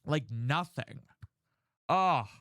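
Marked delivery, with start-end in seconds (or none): 1.9-2.3 frustrated groan. Real, not acted. Frustrated groan about feeling stuck.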